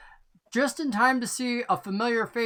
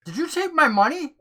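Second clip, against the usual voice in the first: suspicious stranger; 8 semitones higher, 3 dB louder than usual. Acted. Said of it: "he says".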